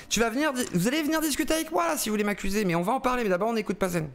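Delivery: very high, fast indignant protest; high heated outburst; heated challenge. Real, not acted. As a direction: push back at the accuser. Defiant challenge to an accuser.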